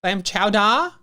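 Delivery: put-on Boston accent. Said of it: "doing the Boston accent".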